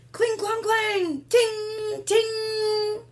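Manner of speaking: high ringing clangs; clashing swords. Acted. Reader voicing swords clashing in battle. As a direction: chime each hit high and bright.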